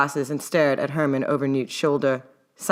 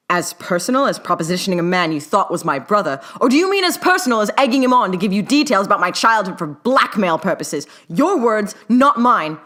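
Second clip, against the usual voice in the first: rising cold anger, louder by the end; temper flaring; 5 semitones higher, 5 dB louder than usual. A narrator's reading. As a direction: bite back, building heat. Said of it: "Herman's temper flared".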